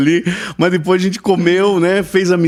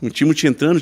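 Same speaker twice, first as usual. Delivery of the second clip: normal talking voice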